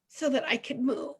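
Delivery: normal talking voice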